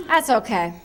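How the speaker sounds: usual speaking voice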